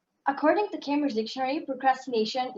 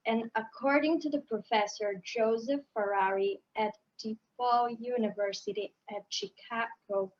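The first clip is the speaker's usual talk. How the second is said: very slow, dragged-out, softer reading; slow motion; 4 semitones lower, 4 dB quieter, slower than usual. Shown as a demonstration. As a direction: stretch every word as if in slow motion. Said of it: "do it in slow motion".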